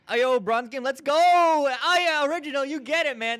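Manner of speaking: hyped-up loud greeting; excited shout. Real, not acted. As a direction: shout it out, fired up. Hyped greeting for bronze game.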